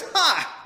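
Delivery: high, loud cry of "Ha!"; demon's cry. A narrator's reading. Preacher reading the possessed man's scream, high and loud. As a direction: bark out the demon's shout. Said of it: "cried out at the top of his voice".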